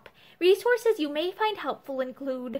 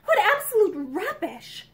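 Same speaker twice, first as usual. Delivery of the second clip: slow, higher scornful denial; angry; 5 semitones higher, 4 dB louder than usual. A narrator's reading. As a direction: brush it off with irritation. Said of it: "she said angrily".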